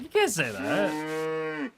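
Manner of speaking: slow, stretched protest; slow mock outrage; slow disbelief. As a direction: drag it out in mock shock. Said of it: "I was like".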